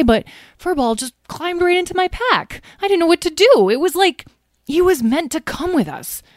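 high, earnest pleading; high-pitched defensive explaining; pleading excuses. A narrator's reading. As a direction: make flustered excuses to an angry friend. Tom pleads and makes excuses.

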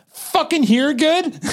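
high, needling sarcasm; sarcastic taunt. Retold or imitated. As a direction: taunt him from the new spot. Stepping back, taunting carny.

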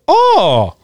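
high cartoon "oh"; Mario-style noise. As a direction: whoop it like a game character.

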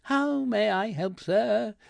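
high, infuriatingly soothing shop assistant; pacifying tone. A narrator's reading. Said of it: "asked in a pacifying tone that was utterly infuriating".

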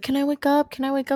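put-on high-pitched kid voice; imitated child asking. Child asking while mother sleeps, imitated kid voice. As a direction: lightly play a child pestering mom.

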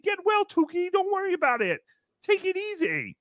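imitated well-wishers. Host quotes listeners' supportive emails, lightly imitated well-wishers.

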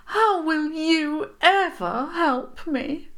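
high, slow, tearful voice; crying mournfully. A narrator's reading. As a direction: lament through tears. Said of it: "she said mournfully".

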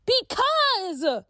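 high, loud frustrated cry; upset outburst. Acted. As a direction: blurt it out upset. Tia still upset.

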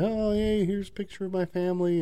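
high, sing-song imitation of a scammer; hammed-up friendly scammer; mock scammer voice. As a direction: pitch it up like a sweet-talking scammer. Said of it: "he was like".

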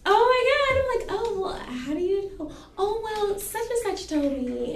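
high-pitched mock astonishment; acted surprise. Hearing who spread her business, high acted surprise. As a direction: play the surprised chat high and exaggerated. Acted exchange over the gossip.

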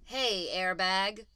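slow, stretched-out taunt; mock name-calling; insulting. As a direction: drag out the name as a jibe. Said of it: "say them like an insult".

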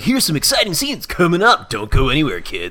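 mock upbeat promo, dropping off at the end; show announcer. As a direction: sell it to the kids like an announcer. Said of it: "they're like".

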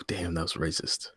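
unvoiced whisper, caught out; hushed guilty aside; sheepish whisper. Real, not acted. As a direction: whisper it, embarrassed at your own joke. Sheepish realization after joke.

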